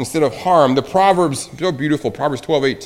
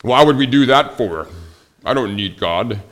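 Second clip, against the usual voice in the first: stubborn retort; 5 dB louder than usual. Retold or imitated. Acted stubborn reply in argument.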